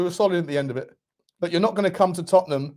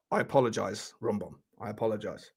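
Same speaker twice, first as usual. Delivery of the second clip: hushed apology; 8 semitones lower, 9 dB quieter than usual. Real, not acted. Humble apology to the player.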